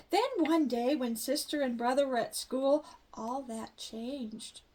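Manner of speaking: ordinary delivery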